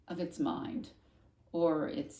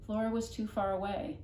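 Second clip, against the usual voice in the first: usual speaking voice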